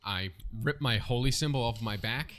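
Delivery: ordinary talk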